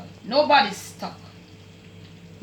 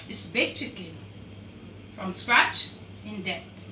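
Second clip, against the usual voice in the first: lower, softer and slow, winding the dish name down; soft closing title; 4 semitones lower, 5 dB quieter than usual. Real, not acted. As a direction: sign off the dish name gently.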